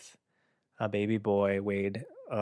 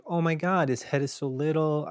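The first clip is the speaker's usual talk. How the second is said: amazed; 6 semitones higher than usual. Retold or imitated. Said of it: "I was like".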